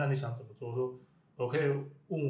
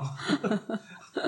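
a short airy laugh that falls away; 9 semitones higher than usual. Real, not acted. Guest chuckling at the start of a podcast chat, airy laugh. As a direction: let out a quick light chuckle.

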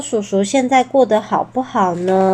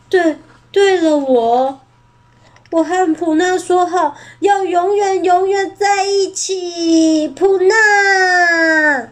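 higher, slow, tearful then crying her name; resolve through tears; 7 semitones higher, slower than usual. Acted. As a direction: summon courage and charge. T-rex finds his courage.

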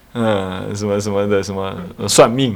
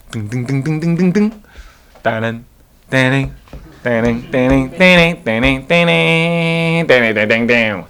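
footstep sounds; slower than usual. Acted. Imitating sneaking footsteps.

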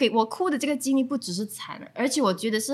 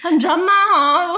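mock dramatic; 6 semitones higher, 6 dB louder, slower than usual. Real, not acted.

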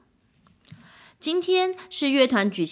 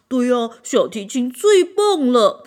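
loud, slow cheering fan; a second instrument cheering the violin on, loud and slow; eager admirer; 3 semitones higher, 8 dB louder than usual. A narrator's reading. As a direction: agree with delight, louder. Another admirer agrees.

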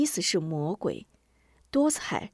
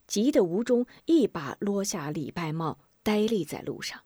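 usual speaking voice

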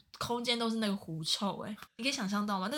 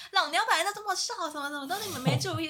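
much higher, louder sassy retort; 10 semitones higher, 5 dB louder than usual. Retold or imitated. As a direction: fire it back up high with attitude. Classmate snapping back when asked. (高声泼辣回嘴)

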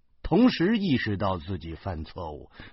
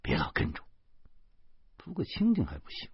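soft, held-down complaint; wife's rebuff; 6 dB quieter, faster than usual. A narrator's reading. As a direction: turn and quietly shake him off. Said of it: "老婆猛然回过头来说".

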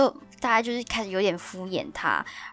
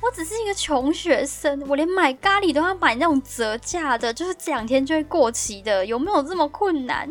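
higher, complaining self-pity; exasperated whining; 6 semitones higher than usual. Real, not acted. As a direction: whine about how hard life is. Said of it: "我好难哦".

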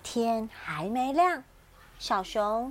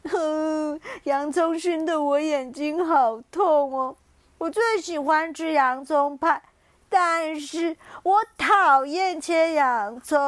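teary lion; 3 semitones higher, 6 dB louder than usual. Acted. Lion tells the little bear about the stinging onions. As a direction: complain through onion tears. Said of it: "狮子眯着眼睛说".

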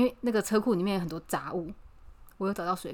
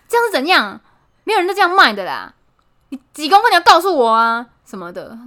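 loud, higher mock yell, stress on 样; the shopkeeper yells at a customer without measurements, mock fury; mock furious yell; 5 semitones higher, 10 dB louder than usual. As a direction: bellow it like an angry old shopkeeper. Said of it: "他就是这么凶的吼".

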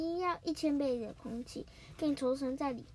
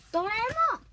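high, loud title shout; excited title call; 10 semitones higher, 8 dB louder, much faster than usual. Acted. Kid announcing the show to viewers, loud and high. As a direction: belt out the show name like a TV opening.

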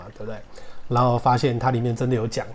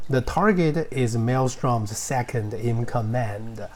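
slow model sentence; much slower than usual. Shown as a demonstration. Teacher slowly models the example sentence to students. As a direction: slowly read English example, every word clear. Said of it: "teacher models example sentence".

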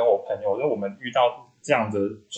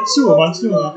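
louder, higher mock bewildered double-take; hammed-up confusion; 7 semitones higher, 10 dB louder than usual. Telling her friend the story, mock bewildered repeat. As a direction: replay your own dumb confusion for laughs. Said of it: "我还想说".

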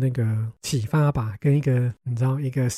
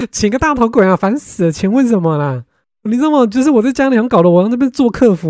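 loud, higher, fed-up rant; exasperated rant; 8 semitones higher, 9 dB louder than usual. Real, not acted. Ranting to viewers about family politeness rules, loud and fed up. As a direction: blow up in mock outrage.

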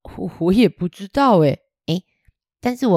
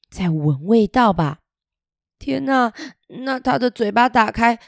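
usual speaking voice

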